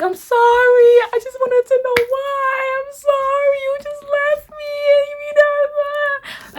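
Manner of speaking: very high, squeaky wailing apology; hammed-up sobbing plea, stretched at the end; mock crying. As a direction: imitate bawling in a thin, high whine. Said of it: "I'm just crying".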